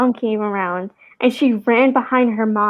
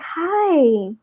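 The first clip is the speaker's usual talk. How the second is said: put-on higher, sing-song voice for a little kid; greeting the little girl who came back, higher; talking to a toddler; 7 semitones higher, slower than usual. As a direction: greet a small child gently. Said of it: "And I was like".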